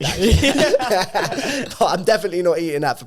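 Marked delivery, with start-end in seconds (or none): 0.4-1.7 high laugh. Real, not acted. Big laugh after joke.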